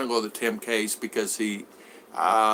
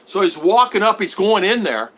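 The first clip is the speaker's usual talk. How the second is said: ordinary delivery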